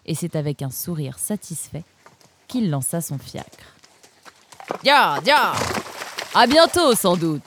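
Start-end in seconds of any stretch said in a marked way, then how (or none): 4.8-7.4 loud, high call to the horses; shout. A narrator's reading. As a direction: yell as the carriage sets off. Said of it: "cria-t-il". (Donsol crie en lançant son fiacre, fort et aigu)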